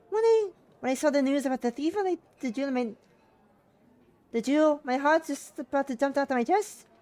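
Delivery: put-on high, loud Russian-accented mother; shaky attempt at Russian accent, female voice; bad Russian accent. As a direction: play a worried mum with a badly faked Russian accent. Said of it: "I don't know this female voice".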